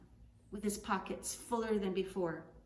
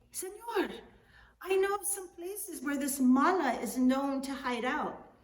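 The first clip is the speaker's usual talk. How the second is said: high, loud sudden idea; eager scheming; 6 semitones higher, 6 dB louder than usual. Acted. Juan offering to hunt himself down, eagerly. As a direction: pitch the plan with eager charm.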